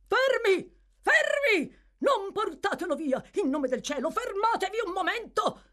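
very high, loud shout to halt; urgent cry. Acted. Newcomer bursts in to stop officers, crying out urgently. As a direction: cry out as if rushing in to stop them. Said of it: "gridò un nuovo venuto".

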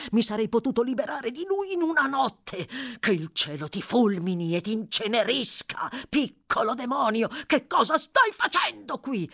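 loud, high raging curses, building to the end; furious railing. A narrator's reading. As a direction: rail at the boy, rising to a furious peak. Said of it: "inveendo in questo modo". (invettiva furiosa, in crescendo)

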